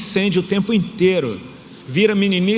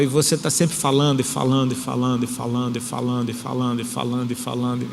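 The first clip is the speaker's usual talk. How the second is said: repeats fading lower and softer each time; endless talking fading out; 3 semitones lower, 3 dB quieter than usual. Retold or imitated. Prophet teasing wife's chatter, fading repetitive mumble. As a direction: mimic chatter trailing off into nothing.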